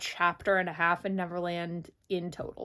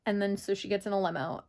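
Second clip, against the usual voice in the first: ordinary talk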